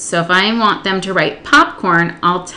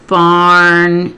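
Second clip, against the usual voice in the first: BARNYARD as two long, loud, separate chunks; broken by syllable; 6 dB louder than usual. Shown as a demonstration. Teacher counting two syllables, syllable by syllable. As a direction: stretch "Barnyard" out, pausing between barn and yard. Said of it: "What are the syllables in barnyard?".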